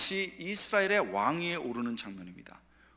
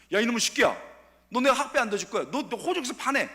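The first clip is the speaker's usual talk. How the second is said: loud, higher hammed-up scolding; mock angry father; 7 semitones higher, 6 dB louder than usual. Pretend father yelling at his son, loud mock fury. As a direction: bark like a furious dad disowning his son. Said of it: "이랬습니까?".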